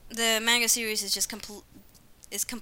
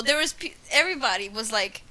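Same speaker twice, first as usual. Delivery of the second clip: normal talking voice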